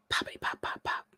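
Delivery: whispered popping sounds; whispering. Shown as a demonstration. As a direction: whisper the pops into the mic.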